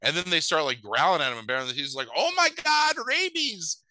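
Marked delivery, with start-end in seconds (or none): 2.2-3.8 very high comic yelp; mock panic. Villain faces growling dogs, hammed-up panic. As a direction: squeal in exaggerated terror.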